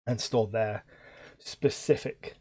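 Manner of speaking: ordinary talk, nothing marked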